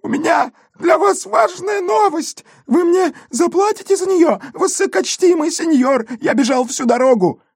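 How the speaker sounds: put-on high, squeaky whining boy; nasal whine. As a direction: whimper it like a begging street kid. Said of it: "плаксивым голосом пропищал".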